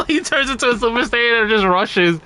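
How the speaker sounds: ordinary talk, nothing marked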